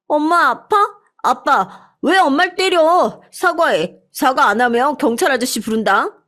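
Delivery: put-on high child's voice, upset and pleading; a small child demanding an apology; child scolding dad. A narrator's reading. Second child scolding father. (아빠에게 사과하라는 아이, 높고 다급한 목소리)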